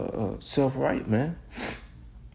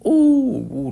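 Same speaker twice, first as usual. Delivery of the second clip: awed whoop; 12 semitones higher, 9 dB louder than usual. Real, not acted. Awed by the Lord's judgment.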